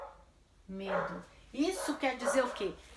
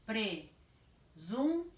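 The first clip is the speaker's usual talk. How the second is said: "Presunto" slow, with long gaps between syllables; much slower than usual. Shown as a demonstration. Teacher dictates while separating, "Presunto" syllable by syllable. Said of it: "eu vou separar".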